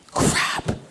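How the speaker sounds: whispered quiet crap; under-breath curse. Retold or imitated. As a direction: mutter it in dread. Comedian's private dread.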